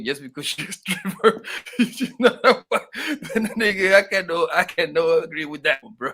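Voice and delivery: laughing through the words; can't stop laughing. Real, not acted. Laughs at stripper wife idea.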